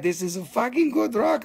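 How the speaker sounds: usual speaking voice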